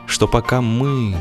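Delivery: ordinary delivery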